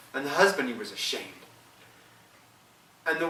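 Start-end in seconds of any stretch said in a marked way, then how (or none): none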